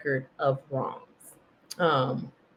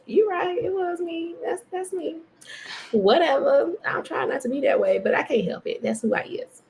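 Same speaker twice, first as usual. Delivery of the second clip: higher, playful you got me confession; resigned self-admission; 10 semitones higher than usual. Retold or imitated. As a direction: concede with a shrug in the voice. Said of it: "And I was just like".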